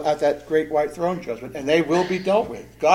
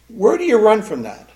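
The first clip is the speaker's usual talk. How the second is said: preaching cry; 6 semitones higher, 7 dB louder than usual. Real, not acted. Climactic preaching question.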